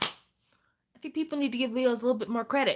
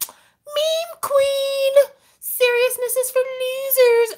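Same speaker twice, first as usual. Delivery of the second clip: put-on much higher, snotty teen taunt; mocking teen voice; 5 dB louder, slower than usual. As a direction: mimic the bratty daughter. Mocking daughter voice.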